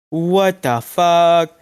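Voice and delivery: a drawn-out, startled outburst; mock shock. A hungry meditator reacting to a strange idea, mock shock. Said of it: "I was like".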